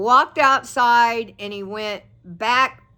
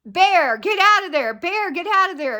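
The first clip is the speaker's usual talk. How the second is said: high, louder shouted warning; rangers yelling; 8 semitones higher, 4 dB louder than usual. Retold or imitated. Rangers shouting at the bear to leave. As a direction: call out to shoo the bear away. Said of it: "yelling, going".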